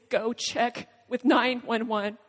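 ordinary talk, nothing marked

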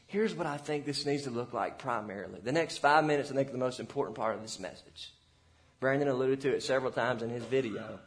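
ordinary talk, nothing marked

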